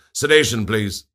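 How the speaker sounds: embarrassed guard barking at the nurse; barked order. A narrator's reading. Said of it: "he barked in embarrassment".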